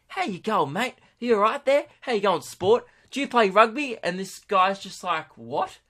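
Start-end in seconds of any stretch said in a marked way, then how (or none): 0.1-4.0 high, sing-song patronising greeting; talking down to a child. Retold or imitated. Tourist bending down to year twelve guy, condescending kiddie voice. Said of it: "with exactly the same tone". 5.4-5.8 high, soft questioning what; mock bewilderment, rising sharply; baffled. Year twelve guy to patronising tourist, baffled. Baffled teenager being talked down to.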